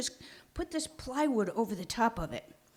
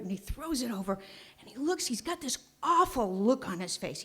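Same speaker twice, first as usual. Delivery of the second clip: usual speaking voice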